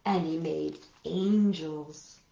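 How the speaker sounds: put-on gentle read-aloud for small children; picture-book narration for little listeners; storybook reading. A narrator's reading.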